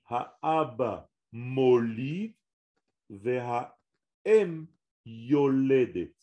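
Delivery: slow, careful Ha'aba molid ve'ha'em yoledet; slow demonstration. Shown as a demonstration. Teacher demonstrating Hebrew grammar slowly, a careful model phrase. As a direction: spell it out word by word. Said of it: "si vous voulez dire".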